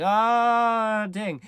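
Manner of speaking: loud, high drawn-out cry; hammed-up dismayed outburst; mock shock. Cops and lovers finding the bodies, mock-shocked.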